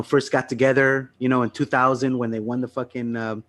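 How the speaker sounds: normal talking voice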